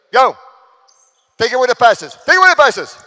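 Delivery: loud urgent shout across the court; coach shouting. Real, not acted. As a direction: yell the command at the players. Coach yelling during drill.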